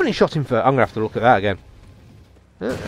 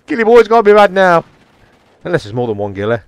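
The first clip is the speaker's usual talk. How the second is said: usual speaking voice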